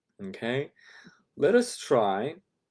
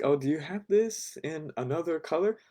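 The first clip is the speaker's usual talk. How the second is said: customer asking; 6 semitones higher than usual. Shown as a demonstration. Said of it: "we could ask".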